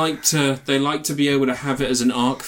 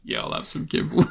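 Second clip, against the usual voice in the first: whispered reply; 3 semitones higher, 4 dB quieter than usual. Retold or imitated. Acting out the dog answering.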